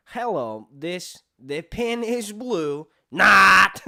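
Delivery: stilted, high foreigner voice bursting into a shout; slow comic setup, then yell the last word; hammed-up accented sarcasm lesson; Borat. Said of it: "teaching him sarcasm".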